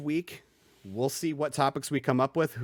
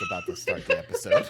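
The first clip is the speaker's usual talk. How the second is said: laughing. Real, not acted. Breaks up laughing mid-intro.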